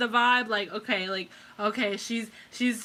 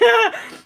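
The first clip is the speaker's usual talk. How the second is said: sudden high laugh at herself; real laugh breaking the tension; burst of laughter; 11 semitones higher, 9 dB louder than usual. Real, not acted. Laughs after emotional outburst.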